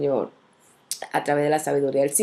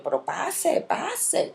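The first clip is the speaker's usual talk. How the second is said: annoyed; 8 semitones higher, 3 dB quieter, much slower than usual. Retold or imitated. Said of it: "yo la digo".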